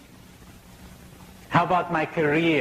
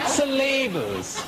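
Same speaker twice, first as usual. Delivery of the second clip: laughing; 12 semitones higher, slower than usual. Real, not acted. Teacher coming back to his point while still laughing. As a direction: still laughing, voice high and slowed.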